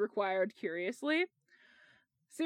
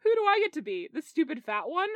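higher-pitched unhappy complaint; 6 semitones higher, 5 dB louder than usual. A narrator's reading. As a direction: protest unhappily, starting high and louder. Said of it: "Peter asked unhappily".

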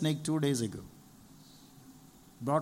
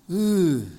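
loud dismayed ooh; a loud ooh of dismay in a mock complaint; 3 semitones higher, 7 dB louder than usual. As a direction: let out an overwhelmed ooh.

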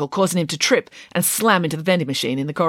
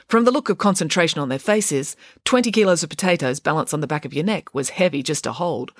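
ordinary delivery, nothing marked

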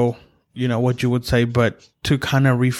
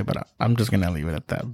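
ordinary talk

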